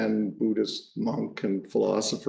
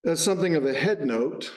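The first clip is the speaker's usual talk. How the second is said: normal talking voice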